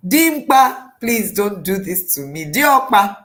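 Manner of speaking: loud acted begging, names cried out; dramatic pleading. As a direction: beg desperately, hammed up. Acting Hagar begging not to go.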